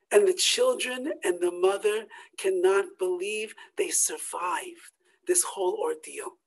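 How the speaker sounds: normal talking voice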